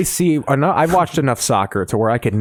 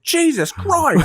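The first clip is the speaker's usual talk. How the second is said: very high, slow, stretched-out cry of shock; high drawn-out yelp of alarm; mock shocked cry; much slower than usual. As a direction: squeal it out slowly like a man seeing a shark. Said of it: "he just goes".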